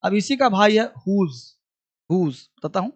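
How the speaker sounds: ordinary talk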